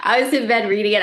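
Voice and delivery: usual speaking voice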